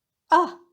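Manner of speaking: a loud, brief falling "啊" of realizing; sudden understanding. Shown as a demonstration. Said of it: "suddenly understand something".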